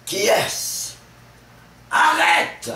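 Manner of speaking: high, furious full-voiced shout; Harpagon seizing the thief. Miser grabs the supposed thief and demands his money, furious shouting. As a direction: roar the demand, stretching the last words out. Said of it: "jusqu'à ce qu'Harpagon, troublé, se saisisse lui-même".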